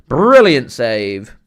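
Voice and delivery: loud, slow, drawn-out praise; loud and slow in admiration; impressed exclamation. Real, not acted. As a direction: savour the save out loud. Reacts to a great save.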